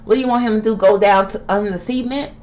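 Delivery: usual speaking voice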